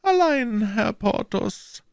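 very high, drawn-out woman's voice; feeble, slow protest from a guilty wife; murmuring wife. A narrator's reading. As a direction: murmur it high, faltering into softness. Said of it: "murmelte die Prokuratorsfrau".

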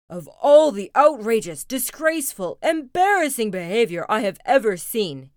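loud, emphatic outraged dad; angry Papa roar. Acted. Papa outraged at the cubs' behavior, loud angry roar. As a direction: thunder out each adjective. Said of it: "he roared".

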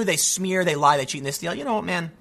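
normal talking voice

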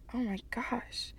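breathless whispered oh my gosh; real whispered aside of dismay. Real, not acted. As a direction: whisper it under your breath. Overwhelmed aside.

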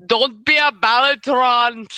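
put-on Austrian accent, "Don't be a Balatron" in action-hero style; Arnold Schwarzenegger impression. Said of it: "as Arnold Schwarzenegger would say".

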